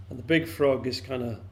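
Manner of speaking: usual speaking voice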